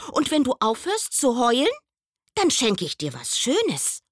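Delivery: hoch, schnell, lockend; er verspricht ein Geschenk; Maulwurf lockt. Acted. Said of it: "sagte der Maulwurf". (schnelle, hohe Maulwurfstimme)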